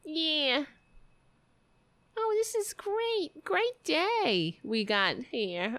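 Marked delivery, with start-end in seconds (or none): 2.2-4.6 high sarcastic gushing; mock delight. Mocking the politician, high sarcastic gushing. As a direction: gush high with fake joy.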